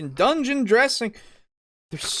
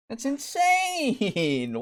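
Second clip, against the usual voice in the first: slow incredulous; 7 semitones higher, 4 dB quieter, much slower than usual. Real, not acted. Repeated exasperation.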